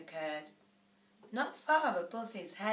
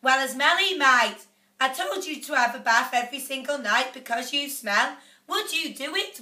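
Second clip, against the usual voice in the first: nagging mother; 4 semitones higher, 6 dB louder than usual. A narrator's reading. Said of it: "the mother said".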